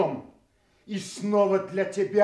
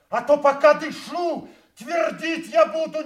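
higher, raised voice of defiance; defiant shout; 8 semitones higher, 4 dB louder than usual. Acted. Kent shouts back at the king, defiant. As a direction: stand your ground and shout back.

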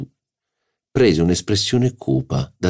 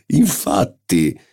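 slow, drawn-out mocking echo; drawn-out agreement; 4 semitones higher, much slower than usual. A narrator's reading. Marquis echoes his own agreement, slow and teasing. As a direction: stretch the second one out. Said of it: "ridendo spavaldamente".

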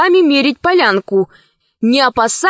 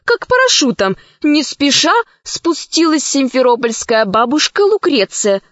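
plain reading voice

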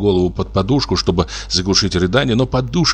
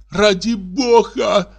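much higher, slow, wailing cry; strange-voiced cry; 13 semitones higher, much slower than usual. Wife in hysterics crying out, much higher, slow wail. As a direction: cry out in a voice not her own. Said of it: "крикнула опять".

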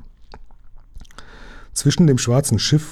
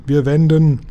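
raised commanding call; captain's order; 5 semitones higher, 5 dB louder than usual. Acted. Captain announcing the turn to his crew, commanding.

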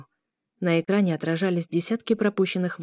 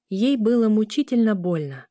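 ordinary delivery

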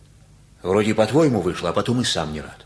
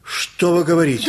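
high, louder astonished question; can't believe what he's hearing; father gasping at the news, astonished; stunned surprise; 6 semitones higher, 4 dB louder than usual. Acted.

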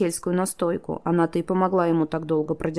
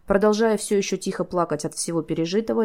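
plain reading voice

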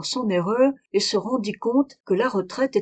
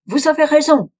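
high, loud cry from hiding; woman's sudden loud cry; 7 semitones higher, 9 dB louder than usual. Acted. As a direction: burst out of the cupboard, louder and higher. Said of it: "s'écria-t-elle avec un accent étranger".

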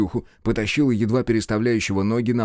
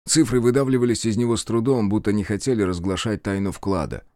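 usual speaking voice

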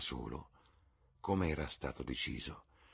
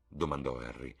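ordinary delivery

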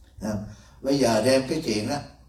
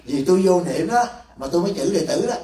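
laughing reply; 4 semitones higher, 3 dB louder than usual. Retold or imitated. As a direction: answer while laughing. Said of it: "Tôi nói". (vừa cười vừa đáp)

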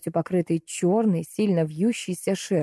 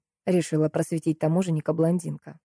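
plain reading voice

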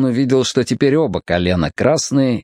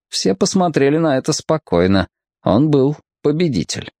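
ordinary delivery, nothing marked

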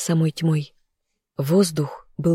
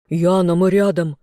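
ordinary delivery, nothing marked

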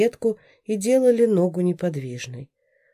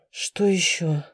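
whispering; slower than usual. A narrator's reading. Thinking to herself.

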